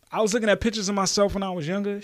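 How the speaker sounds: usual speaking voice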